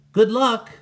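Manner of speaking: high, loud call after the duck. Acted. Walter calls good luck to the flying duck, loud. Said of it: "Walter called to him".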